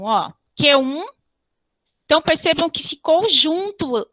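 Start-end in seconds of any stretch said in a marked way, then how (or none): 0.6-1.1 "Q um" fused into a single syllable; "Q um" as one joined beat; elision demo. Shown as a demonstration. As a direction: run "Q um" together with no break. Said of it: "não há uma separação".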